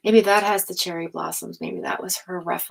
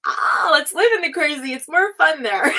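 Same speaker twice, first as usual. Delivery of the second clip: higher, louder, giddy and laughing; carefree Gemini; 8 semitones higher, 8 dB louder than usual. Retold or imitated. Imitating a Gemini who loves the crazy, giddy and laughing. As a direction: sell the fun of chaos. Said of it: "Gemini is more like".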